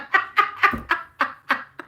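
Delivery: high burst of breathy laughter; giggling laugh. Real, not acted. Reader laughing about people mispronouncing her name, high laughter.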